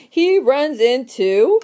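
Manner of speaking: normal talking voice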